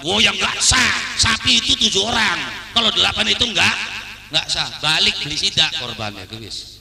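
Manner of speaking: emphatic cleric laying down the rule; modern-day strict kiai. Acted. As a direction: rebuff them forcefully as a rigid cleric. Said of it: "Itu kiai zaman now".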